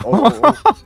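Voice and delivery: excited yelling. Real, not acted.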